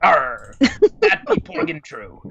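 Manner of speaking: high, slow hammy pirate. Pretend. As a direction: squeak it like a cartoon pirate. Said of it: "the pirate voice".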